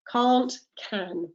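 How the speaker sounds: "cahnt" for Can't, then short-a "can"; British Can't versus can; vowel contrast. Shown as a demonstration. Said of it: "pronunciation of my vowel changes".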